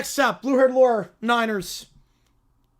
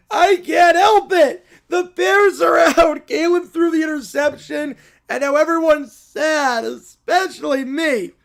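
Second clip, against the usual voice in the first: loud tantrum; 4 dB louder than usual. Acted. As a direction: wail like a child in a tantrum. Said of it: "you're being very loud".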